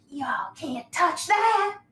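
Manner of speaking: loud, high, drawn-out creature cry; Hoki's taunting cry. Acted. Creature warns off intruders at the pool, loud high cry. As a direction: stretch it out slowly, rising high and loud. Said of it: "Hoki's like".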